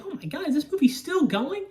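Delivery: high-pitched retold exasperation; exasperated disbelief. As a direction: act out being unable to believe it. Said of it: "And I was like".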